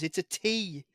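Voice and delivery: ordinary delivery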